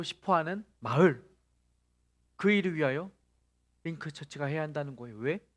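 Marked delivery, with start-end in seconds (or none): none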